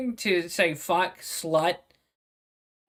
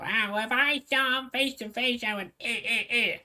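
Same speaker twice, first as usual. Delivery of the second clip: mock; 4 semitones higher than usual. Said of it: "You kinda did just".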